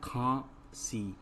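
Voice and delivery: खांसी slow, in two separate syllables; खांसी with a gap between its syllables. Shown as a demonstration. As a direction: sound out खांसी in two pieces. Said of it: "तो क्या शब्द बना".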